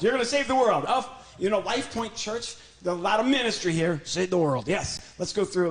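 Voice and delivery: ordinary talk, nothing marked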